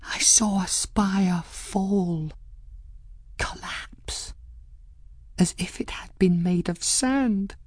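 slow, haunted whisper; hushed, breath-only horror. A narrator's reading. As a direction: whisper the vision, piece by piece. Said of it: "before finishing in a whisper".

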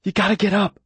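usual speaking voice

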